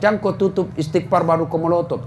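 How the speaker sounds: usual speaking voice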